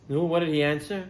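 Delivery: ordinary talk, nothing marked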